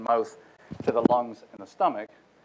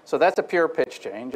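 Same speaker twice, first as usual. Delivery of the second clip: normal talking voice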